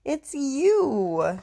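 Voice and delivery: high, amused reveal; gleeful drawn-out answer; laughing reply. Acted. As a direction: say it high, as if tickled. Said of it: "laughed and said".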